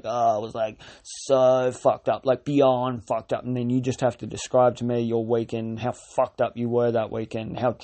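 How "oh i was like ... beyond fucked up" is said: slow bragging drawl. Retold or imitated. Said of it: "you're like".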